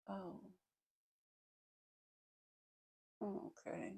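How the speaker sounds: very soft, hesitant mock "oh"; hushed, imitating someone unsure what to say; taken-aback quiet reaction. Onlooker reacting to seeing her cry, quietly taken aback.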